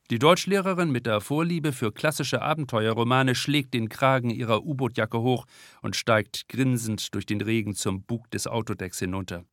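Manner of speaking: plain reading voice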